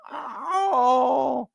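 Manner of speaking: high put-on cry of a player acting hurt; mock pained yelp. Mimicking a diving player's fake pain cry. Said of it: "he was".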